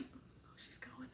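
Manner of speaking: barely audible whispered play-by-play; stealthy whisper. Retold or imitated. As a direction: whisper it while spying on her. Imitates friend watching for tears.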